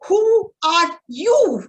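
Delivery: high, loud, slow angry demand; a father in a rage at his wife; furious father. Retold or imitated. Angry father roaring at mother who intervened. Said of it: "my father got so furious and said".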